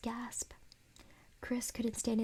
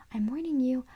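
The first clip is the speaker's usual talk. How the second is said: louder pitched-up warning; screamed threat; 3 semitones higher, 3 dB louder than usual. Acted. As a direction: scream threats while carried. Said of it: "the dummy screamed".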